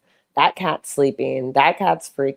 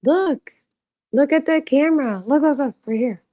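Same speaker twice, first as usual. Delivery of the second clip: high, louder pet voice; 9 semitones higher, 3 dB louder than usual. Real, not acted. Owner getting her cat to look at the camera, high baby-talk call. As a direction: call the cat's attention up high.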